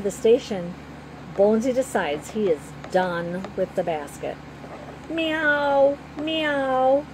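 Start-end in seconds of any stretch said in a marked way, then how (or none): 5.1-7.0 high rising meows like a small kitten. A narrator's reading. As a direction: mew like a hungry kitten.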